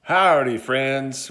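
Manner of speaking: loud drawn-out Texas cowboy greeting; hammed-up Texas accent, big and loud. Shown as a demonstration. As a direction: Texas cowboy hollering howdy to the crowd. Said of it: "I've lost my Texas accent".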